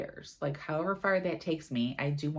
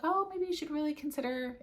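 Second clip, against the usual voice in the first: higher, lightly voiced commenter, no real accent; hint at the British viewer without doing the accent; quoting a British commenter; 12 semitones higher than usual. Vlogger quoting a British commenter's advice, lightly voiced and higher. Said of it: "if I could do a British accent here, I would, but I can't".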